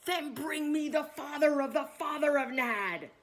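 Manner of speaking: loud, high-pitched command from the king; royal order. A narrator's reading. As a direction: raise your voice and order it as king. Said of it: "ordered the king".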